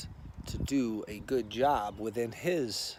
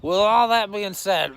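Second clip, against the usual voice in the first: loud; 8 semitones higher, 10 dB louder than usual. Real, not acted. Loud restart after brr.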